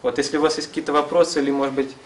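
ordinary delivery

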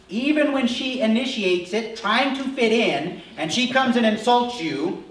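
rising emphasis. Real, not acted. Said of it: "preaching with rising force".

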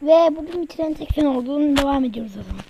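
ordinary delivery

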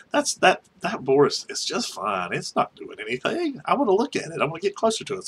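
high-pitched brushing off the danger; retold dismissive reassurance. Driver tells nervous coworker the boar is fine, dismissive. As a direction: pitch it up, waving away his friend's worry.

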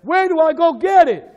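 high, loud, hammed-up asking; loud mock question. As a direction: shout it as a clueless seeker. Acting out a foolish seeker.

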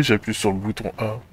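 usual speaking voice

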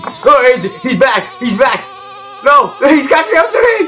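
high, loud panic scream; frantic gamer screaming in alarm; panicked yelling. Real, not acted. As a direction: shriek as the chaser closes in. Panicked as chaser returns.

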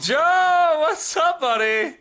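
very high, loud hollered welcome; excited shouted greeting. Real, not acted. Streamer welcoming a new subscriber, high excited shout. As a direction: holler a thrilled hello.

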